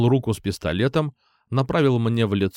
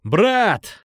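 high-pitched call to a friend; raised, pleading shout; shouted appeal; 9 semitones higher, 3 dB louder than usual. A narrator's reading. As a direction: cry out to reach him from afar. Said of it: "снова закричал я".

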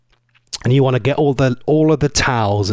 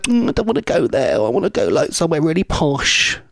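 posh Kylie; 3 semitones higher than usual. Retold or imitated. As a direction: sniff at the place like it's beneath her. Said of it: "she'd be like".

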